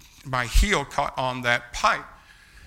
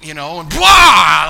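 a big loud blurted yell of pain; 5 semitones higher, 13 dB louder, slower than usual. Retold or imitated. Reliving the mower landing on his foot, loud pained yell. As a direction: let the yell burst out, loud and long.

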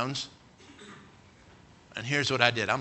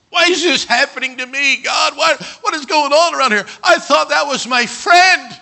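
a much higher, louder pleading shout; anguished cry to God; 13 semitones higher, 11 dB louder than usual. Acted. Believer in the heat of battle shouting his anguish at God. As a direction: cry out in betrayed confusion.

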